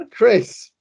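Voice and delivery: ordinary delivery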